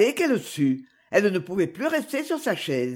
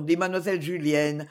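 ordinary delivery, nothing marked

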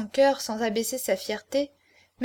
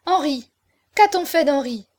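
higher, urgent demand; crying out; 4 semitones higher, 6 dB louder than usual. A narrator's reading. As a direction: cry out for news of him. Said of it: "s'écria Aurore". (exclamation pressante et angoissée)

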